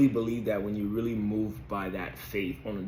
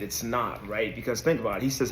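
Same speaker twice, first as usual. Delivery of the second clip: usual speaking voice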